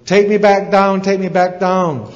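high, loud acted begging; frightened eaglet calling out; panicked plea. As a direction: cry out to be let down. Terrified eaglet begs owl mid-flight.

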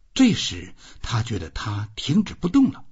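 ordinary delivery

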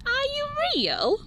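small voice full of wonder; wondering child. Acted. Nora asks tiger, wondering.

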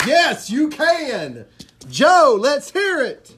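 loud, high, slow hype call; hype shout. Real, not acted. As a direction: pump up the crowd. Hyping Joe to do bird call.

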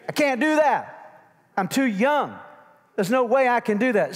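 high, loud mock protest; protesting young Jeremiah. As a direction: play a reluctant prophet crying out his excuses. Voices Jeremiah's protest to God.

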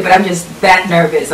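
normal talking voice

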